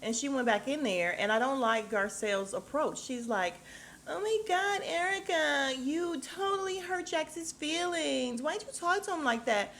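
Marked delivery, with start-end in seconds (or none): 4.1-9.7 high, slow, whining complaint; mock her whining, pitched way up and drawn out; whiny imitation. Mocking Garcelle whining to Erica about Jax, high and drawn out. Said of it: "why are you whining".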